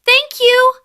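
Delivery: very high, loud mouse squeak; squeaky thanks. Acted. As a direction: squeak a grateful thank you. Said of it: "Mimi squeaked".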